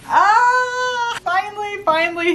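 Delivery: a high squeal of joy, then settling down; excited squeal. Real, not acted. Fan squealing over her vinyl, then excited. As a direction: gush over the long-awaited package.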